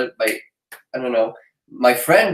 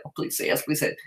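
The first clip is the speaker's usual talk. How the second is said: hushed, whispered inner wish; whispered plea; 10 dB quieter, faster than usual. Retold or imitated. Silently willing a friend to say yes, whispered plea. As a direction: whisper it like a secret hope.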